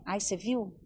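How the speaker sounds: catty gossip. Retold or imitated. Women gossiping once Tupi leaves, low and catty. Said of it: "a Tupi sai e a gente fala assim".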